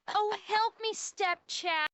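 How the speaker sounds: high, very soft helpless begging; soft mock plea. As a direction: plead feebly, mock-helpless and hushed. Soft first take of meme plea.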